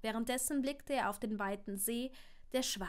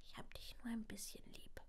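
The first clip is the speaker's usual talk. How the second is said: put-on soft little-boy whisper; child's whisper; 13 dB quieter than usual. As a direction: whisper it shyly, half teasing. Child's teasing bedtime reply.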